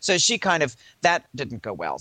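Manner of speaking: normal talking voice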